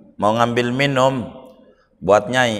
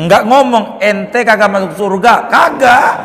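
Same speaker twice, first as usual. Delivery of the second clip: louder and higher, punching the denial; emphatic denial; 7 semitones higher, 4 dB louder than usual. Real, not acted. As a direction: hammer home "Kagak". Menegaskan Nabi tidak berkata begitu. (tegas dan lantang, tekan "Kagak")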